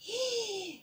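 high, soft playful gasp; gasp of surprise. Pretend. Teacher revealing the next picture, playful gasp. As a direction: suck in air in mock amazement.

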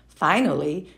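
ordinary delivery, nothing marked

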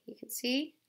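plain reading voice

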